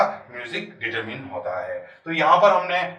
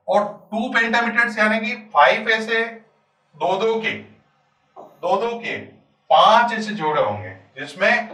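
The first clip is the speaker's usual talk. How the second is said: ordinary talk, nothing marked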